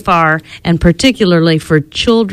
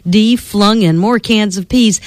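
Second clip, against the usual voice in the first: ordinary delivery, nothing marked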